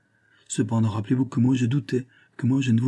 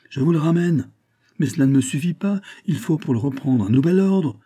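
higher-pitched, insistent objection; agitated protest; 6 semitones higher, 3 dB louder than usual. A narrator's reading. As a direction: protest with rising alarm, mildly acted. Governor protests in an acted quarrel.

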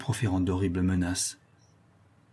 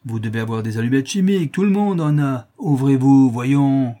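loud, building to a shout; rowdy hollering at a door; impatient demand; 4 semitones higher, 7 dB louder than usual. A narrator's reading. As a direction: demand entry, louder as you go. Drunk demands the door be opened.

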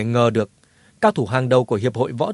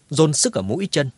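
plain reading voice

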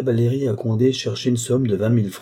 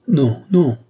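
a sharp, loud, higher cry of refusal; 5 semitones higher, 7 dB louder than usual. A narrator's reading. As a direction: burst out with the two noes loud and high. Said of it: "s'écria-t-elle".

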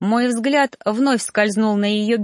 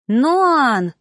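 high, loud shout of a name; 6 semitones higher, 5 dB louder than usual. A narrator's reading. Girl shouting after the teacher from behind. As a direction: call him back from afar. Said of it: "послышался крик за спиной".

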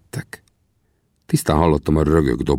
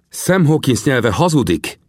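slow, measured accusation; higher, deliberate cold rebuke; cold Winnetou; 9 semitones higher, 3 dB louder than usual. A narrator's reading. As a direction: answer coldly and slowly. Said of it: "felelte Winnetou hidegen".

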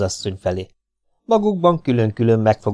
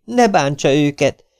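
higher, pleading woman's voice, stepping in; 6 semitones higher, 4 dB louder than usual. Acted. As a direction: plead with him to spare them. Hollóné begs Kinizsi. (magasabb, könyörgő asszonyhang)

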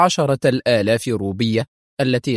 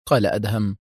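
ordinary delivery

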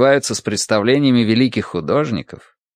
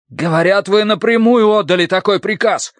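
high-pitched challenge; raised, high, indignant charge; heated accusation; 11 semitones higher than usual. A narrator's reading. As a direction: confront him with the rumour. Sergeant accuses minister amid shouting.

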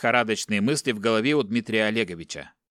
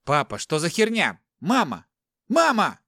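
rising, louder panicked cry; screaming for mom; 6 semitones higher, 3 dB louder than usual. Acted. Son seeing his bound mother, panicked scream. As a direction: scream Мама, breaking high. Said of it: "закричал он".

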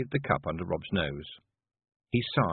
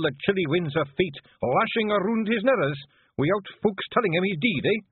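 high, exasperated Scots rant; indignant clan chief; 11 semitones higher, 4 dB louder than usual. Acted. Chief interrupted in his existential dread, indignant. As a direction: protest loudly, utterly fed up.

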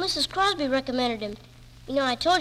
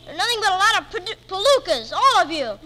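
louder, higher indignant scolding; angry retort; 4 semitones higher, 6 dB louder than usual. Acted. Boy shouts back at his mean aunt in anger. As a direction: tell them off hotly.

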